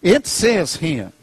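normal talking voice

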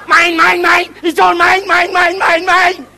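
loud, high, greedy cartoon squawk; Daffy Duck. Quoting a cartoon to explain the outburst, mock greedy duck voice. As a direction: rattle it off like a possessive cartoon duck. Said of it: "Daffy Duck".

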